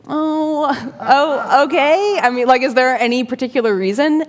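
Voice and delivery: high, hesitant retold reply; taken aback. Asked by a stranger for a picture, taken aback. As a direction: act out being thrown by an odd request.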